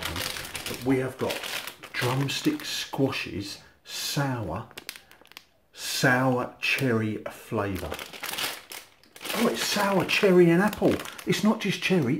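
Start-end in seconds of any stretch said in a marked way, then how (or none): 1.9-7.9 slow reading. Real, not acted. Reading the flavour off the packet. 9.4-12.2 high, loud surprised realisation; suddenly noticing it on the label. Real, not acted. Surprised on reading the packet again.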